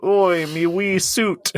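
slow, put-on Cockney Oi; lightly voiced British lad; hint of a cheeky London accent; mock British. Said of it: "he looks like someone who'd be like".

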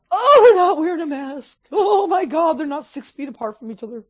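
high, shocked gossip voice; mock scandalized neighbor. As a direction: clutch your pearls and tattle. Mocking mask-snitching neighbors.

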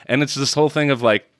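ordinary talk

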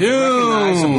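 mock spooky doom. Joins the spooky doom bit.

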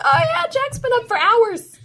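put-on high, chirpy child's answer; little daughter voice. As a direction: answer like an eager little girl. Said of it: "she's like".

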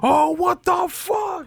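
high, drawn-out panicked shout; mock panic. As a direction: act out a control room losing it. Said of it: "they in the control losing it".

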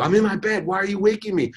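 high-pitched, indignant comeback; angry, defensive. Retold or imitated. Woken daughter snapping back at dad, angry and defensive. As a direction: act out a daughter protesting, pitched well up. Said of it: "she would have answered in anger".

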